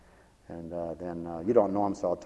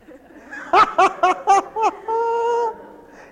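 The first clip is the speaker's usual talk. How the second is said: long, high burst of laughter; high loud laugh; 7 dB louder than usual. Real, not acted. Preacher laughing at his own joke, high loud laugh.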